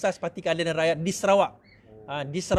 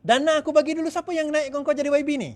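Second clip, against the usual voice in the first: mock scolding; 7 semitones higher than usual. Confronting defector.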